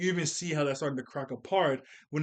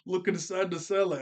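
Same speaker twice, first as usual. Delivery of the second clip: normal talking voice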